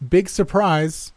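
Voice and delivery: high, slow call across the house; shouting back. A narrator's reading. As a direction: call it out from another room. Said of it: "I shouted back".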